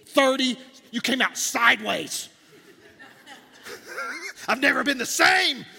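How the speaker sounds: high, loud guilt-tripping mother with a laugh; dramatic mom. Retold or imitated. Mom reminds son of birth, hammed-up loud lament. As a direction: ham up the martyr mom. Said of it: "those moms that talk to you about your birth experience".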